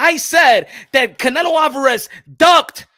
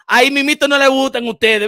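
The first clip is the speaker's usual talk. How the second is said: ordinary delivery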